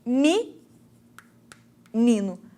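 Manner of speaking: "mi, nino" with an I in the first syllable; I instead of E. Shown as a demonstration. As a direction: say "mi, nino" as MI-nino, with a long break after MI. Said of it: "o I, em vez do E".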